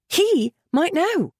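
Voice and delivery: high, slow outburst; bursting out. A narrator's reading. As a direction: blurt it out, high. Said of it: "Merritt burst out".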